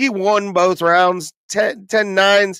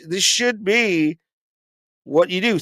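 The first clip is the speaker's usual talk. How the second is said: ordinary delivery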